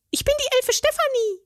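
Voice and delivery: very high, prim fairy voice; a little curtsy in the voice; fairy introducing herself. A narrator's reading. Fairy Stefanie introduces herself to the troll, high and prim. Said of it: "stellte sie sich mit einem Knicks vor".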